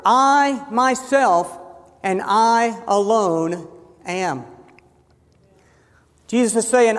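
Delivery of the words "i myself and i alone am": slow, weighty stress on "I myself" and "I alone"; emphatic stress. Shown as a demonstration. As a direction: set "I myself" apart, pause, then land "and I alone am" deliberately. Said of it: "Jesus is emphasizing through the grammar".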